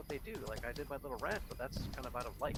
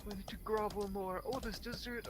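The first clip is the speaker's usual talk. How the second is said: mock groveling guest; 9 semitones higher than usual.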